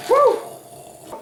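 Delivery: high whooping cheer; excited cheer. Real, not acted. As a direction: whoop in triumph. Cheering the kill.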